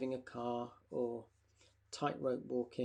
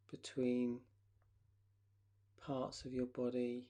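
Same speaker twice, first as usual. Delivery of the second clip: guided relaxation. Acted.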